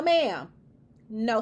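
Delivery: normal talking voice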